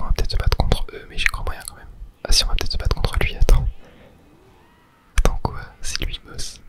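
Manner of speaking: usual speaking voice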